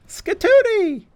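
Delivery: very high, squealed cartoon show cry; cartoon announcer. Recalling a Cartoon Network show, high cartoon cry. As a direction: shriek it like a zany kids' TV host. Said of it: "by saying the word Skatoony".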